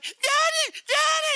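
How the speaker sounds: high loud joyful call; mock child yell; excited child shout. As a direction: shout for daddy like a relieved kid. Lost son spots his dad.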